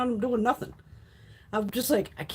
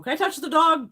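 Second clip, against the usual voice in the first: high, louder mimicked question; eager asker; 9 semitones higher, 6 dB louder than usual. Stranger asking to pet a service dog, mock eager. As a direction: pipe up as an eager dog-petter.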